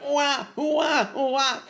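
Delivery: put-on high-pitched baby wail 'ua'; put-on infant bawling; imitated baby crying. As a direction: mimic a newborn's cry. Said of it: "cuando el niño empieza a llorar".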